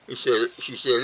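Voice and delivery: usual speaking voice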